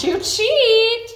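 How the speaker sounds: very high, loud, drawn-out warning; playful warning. Real, not acted. Trainer warning her dog off the treats, high, loud and slow. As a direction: stretch it out loud to stop him cheating.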